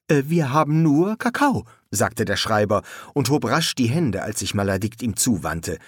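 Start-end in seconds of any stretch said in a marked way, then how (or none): 0.1-1.7 higher, hesitant excuse; nervous. A narrator's reading. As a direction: offer it timidly after a hesitation. Scribe threatened, raises hands hastily.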